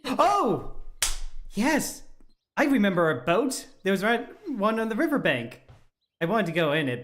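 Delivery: put-on high, loud eager kid voice; excited child. Excited child recalls boat.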